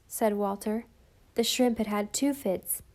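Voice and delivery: ordinary delivery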